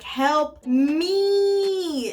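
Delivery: loud, high, stretched-out cry for help; hammed-up desperate plea; SOS plea. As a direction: wail it like a mock distress call. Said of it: "I send my friend an S O S".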